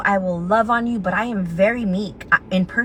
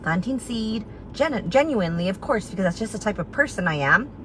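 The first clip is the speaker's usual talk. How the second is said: ordinary delivery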